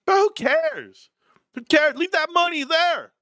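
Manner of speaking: high, hammed-up exclamation; mock-exasperated high-pitched cry; retold outburst. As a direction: imitate a friend exclaiming, pitch way up. Retold friend's reply, acted out.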